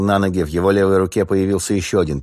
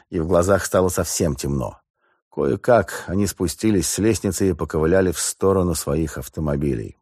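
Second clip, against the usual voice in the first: ordinary delivery, nothing marked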